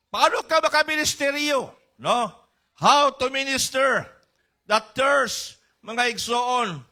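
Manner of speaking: high, loud, fervent preaching; preaching at full force. Acted. Preacher urging the congregation to minister, at full force. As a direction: lift the room, proclaim it loudly.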